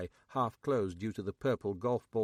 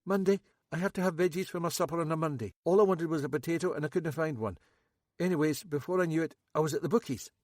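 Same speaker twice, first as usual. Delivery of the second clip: higher, louder nervous local man; Max; 6 semitones higher, 4 dB louder, faster than usual. A narrator's reading. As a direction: play Max high and jittery. Max voiced.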